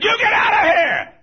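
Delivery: high, very loud imitated scream; full-voiced mock screaming; hollering mother. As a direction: holler like a mother chasing someone off. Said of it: "she hollered and screamed at him".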